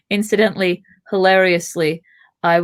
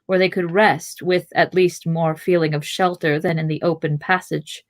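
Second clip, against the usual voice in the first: ordinary delivery, nothing marked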